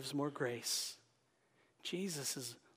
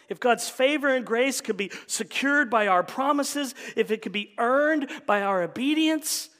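loud, raised preacher's voice; emphatic preaching; 6 semitones higher, 9 dB louder than usual. Real, not acted. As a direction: drive each phrase home from the pulpit. Preaches at full force.

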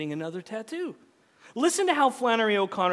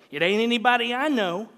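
raised, louder rebuff; acted scornful comeback; dismissive retort; 5 semitones higher, 6 dB louder than usual. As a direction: brush him off as the country wife. Wife retorts in story.

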